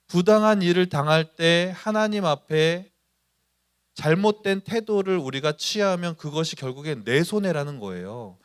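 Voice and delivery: ordinary talk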